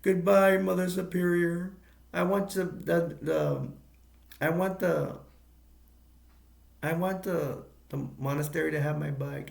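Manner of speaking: butter up the nun in a high, sugary voice; mock-polite little boy to Mother Superior; talking all nice. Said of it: "he starts talking all nice to her".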